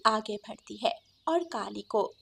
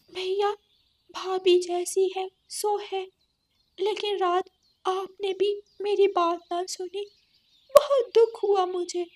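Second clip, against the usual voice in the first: hurt sister; 9 semitones higher than usual. Acted. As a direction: tell him how he hurt you. Kali to brother.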